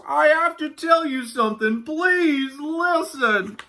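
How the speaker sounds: high, drawn-out pleading wail; crying-out Walrus. A narrator's reading. Walrus begging Yak to listen, crying out. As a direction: beg in a high cry, stretching the last words. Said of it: "cried Walrus".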